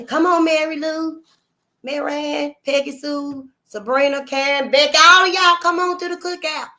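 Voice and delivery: high, loud call to the cookout; hollering the invite across the yard. Pretend. Sister inviting everyone to the cookout, loud high call.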